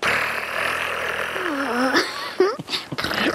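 long soft purr; cat purring. Acted. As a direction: trill a purr like a contented cat. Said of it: "And a cat goes".

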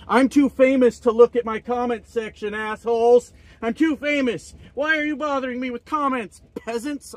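hammed-up snob dismissing fans; mock arrogant celebrity. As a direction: sneer down at the peasants.

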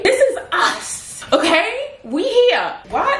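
rally your crew with a laugh; high excited bragging to her followers; hyped up. Real, not acted. Hyping up her army.

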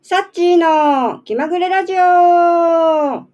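高めに張り上げ、語尾を長く伸ばす; ラジオ番組名を元気にゆっくりコールする; タイトルコール. Real, not acted. 番組冒頭のタイトルコール. (声を張って伸ばすタイトルコール)

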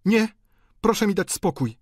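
high, indignant countess's outcry; high-pitched rebuff; countess crying out. A narrator's reading. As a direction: cry out in a high voice to be left alone. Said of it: "zawołała hrabina".

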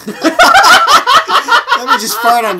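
high, loud laughing. Real, not acted. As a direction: cackle the words out. Joking at friend, laughing.

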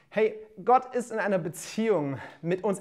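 ordinary delivery

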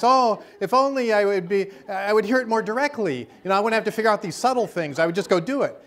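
high, loud mocking whine. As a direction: parody people who wish for clear signs. Mocking what everybody says.

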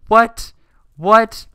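high loud disbelieving cry; shocked disbelief. Real, not acted. Disbelief after losing.

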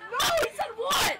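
high loud disbelieving yell; shocked shout. Real, not acted. Shocked reaction to a word.

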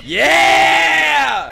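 high, loud drawn-out "yeah"; cheering shout. Real, not acted. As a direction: roar it like a gym hype. Cheering at a workout scene.

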